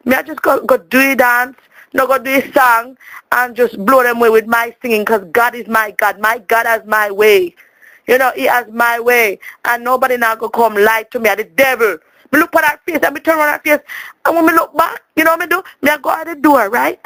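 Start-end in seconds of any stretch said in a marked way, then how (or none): none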